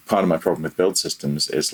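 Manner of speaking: normal talking voice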